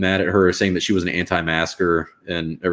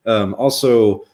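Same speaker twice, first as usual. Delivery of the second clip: ordinary talk, nothing marked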